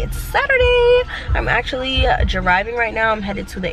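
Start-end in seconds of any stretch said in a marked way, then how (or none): 0.0-1.0 excited. Real, not acted. Cheery greeting repeated.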